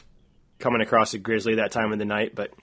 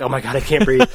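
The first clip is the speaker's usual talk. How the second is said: mock panicked gasp; 2 semitones higher, 7 dB louder than usual. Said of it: "she's like".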